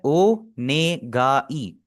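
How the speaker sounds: ओनेगाई spelled out very slowly; teacher slowing down to model a word; slow syllable demo. Shown as a demonstration. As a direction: stretch ओनेगाई slowly for the class. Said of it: "pronunciation में थोड़ा सा focus कीजिएगा".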